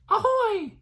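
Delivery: very high squeaky Ahoy; high baby pirate. Acted. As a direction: pipe it up like a tiny pirate. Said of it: "bonny baby pirate in training".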